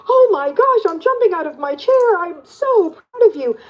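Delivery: high-pitched, delighted outburst for a patient; gushing with joy over their good news; excited cheering. Retold or imitated. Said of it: "I'm like".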